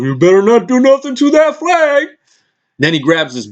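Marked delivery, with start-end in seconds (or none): 0.0-2.1 high, loud mock Irish snarl; angry Conor impression. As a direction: bark it like a riled-up fighter protecting his flag. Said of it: "Conor got all pissed off".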